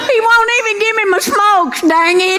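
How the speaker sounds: high, laughing, whiny complaint to the officer; whiny trailer wife. Retold or imitated. Woman on Cops complains to the officer, high and whiny. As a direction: wail like a fed-up trailer wife.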